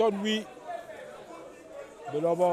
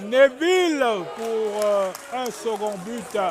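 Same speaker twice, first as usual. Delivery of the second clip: drawn-out, louder shout of the scorer's name; excited goal call; 2 semitones higher, 5 dB louder, slower than usual. Real, not acted. Goal call in live commentary.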